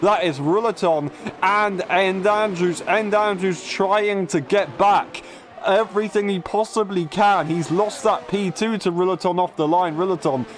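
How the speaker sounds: excited play-by-play, voice lifted; motorsport commentator. Real, not acted. Live race play-by-play.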